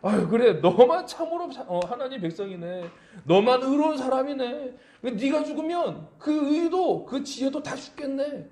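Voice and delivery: sarcastic mockery. Job mocking his know-it-all friends, sarcastic and sneering. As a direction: needle them with fake praise. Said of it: "비꼬는 그런 이야기".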